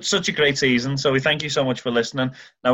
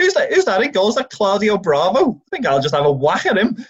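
high-pitched playful imagined thought; mock cheeky player; 8 semitones higher, 3 dB louder than usual. Podcaster voicing Fabinho eyeing the goal, cheeky high mock voice. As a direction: pitch it up, acting out a player sizing up the keeper. Acting out Fabinho spotting the keeper.

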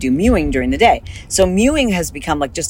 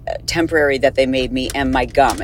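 normal talking voice

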